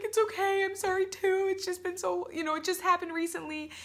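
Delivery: high-pitched, whiny imitated weeping; mock crying. Magazine owner answers while both are crying, mock tearful voice. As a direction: reassure through hammed-up tears, voice held high. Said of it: "So we're both, like, crying".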